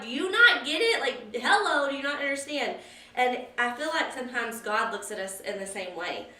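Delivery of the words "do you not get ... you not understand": high, loud incredulous hello; exasperated. Retold or imitated. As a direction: hammer the disbelief, like they're clueless. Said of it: "he's still like".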